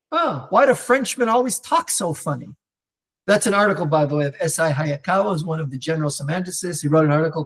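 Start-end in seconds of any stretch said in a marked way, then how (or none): 0.1-2.6 higher, incredulous mock whine; sneering mock of a know-nothing teen; mock naive teen. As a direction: play the clueless kid baffled by foreigners. Mocking a provincial American teen.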